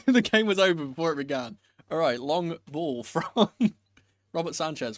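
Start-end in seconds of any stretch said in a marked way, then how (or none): 0.1-1.6 laughing through the words; chuckling at own mistake. Real, not acted.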